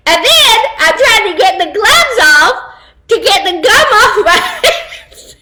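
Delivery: can barely get it out for laughing; giggling helplessly while telling it. Real, not acted. Laughing through retelling gum mishap.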